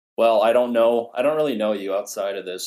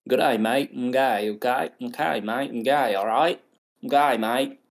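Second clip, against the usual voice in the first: hammed-up Aussie accent, pitched higher; Australian accent; 4 semitones higher than usual. Host showing off to co-host, mock Australian accent. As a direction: repeat it in a broad mock Australian twang. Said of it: "do an Australian accent".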